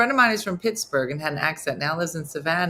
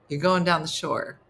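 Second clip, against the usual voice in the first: usual speaking voice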